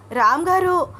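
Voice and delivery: loud, high call for help; pained shout. A narrator's reading. Wife in labour calls sleeping husband, pained shout. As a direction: cry out sharply to wake him. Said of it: "అంటూ గట్టిగా అరిచింది అనన్య".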